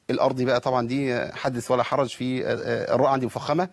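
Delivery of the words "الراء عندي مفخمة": ordinary talk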